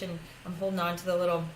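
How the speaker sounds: usual speaking voice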